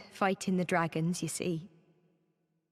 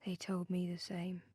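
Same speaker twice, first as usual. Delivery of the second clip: whisper; 9 dB quieter than usual. Acted.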